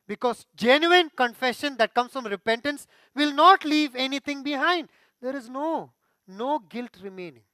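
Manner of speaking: loud, high preaching climax; pulpit-pounding sermon delivery; fiery preaching. Acted. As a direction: proclaim it to the whole hall. Preaching at full force.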